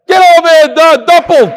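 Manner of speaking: high, loud shout across the room. Real, not acted. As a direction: yell his name to call him up. Calling the emailer up front.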